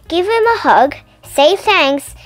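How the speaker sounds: plain reading voice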